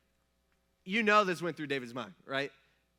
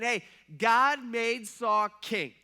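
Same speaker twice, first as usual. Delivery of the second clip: louder, slowed-down plea; insistent David; 5 semitones higher, 7 dB louder, much slower than usual. Retold or imitated. David urges his men, loud and slow. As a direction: press each word on the men, slowly.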